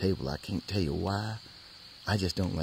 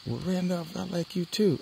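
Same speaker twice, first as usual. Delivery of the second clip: voicing the girl; 12 semitones higher than usual. Voicing the girl's reply.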